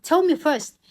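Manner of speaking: pushing grandmother to name the suitor, loud and insistent; demanding. Retold or imitated. Girl demands to know the suitor.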